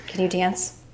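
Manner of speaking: usual speaking voice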